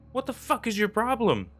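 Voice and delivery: high-pitched angry demand; pissed, scared shout. A narrator's reading. Stacy to screaming Hutch, pissed but scared shout. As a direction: snap it out high, rattled. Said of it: "Stacy shouted at him, pissed but also scared".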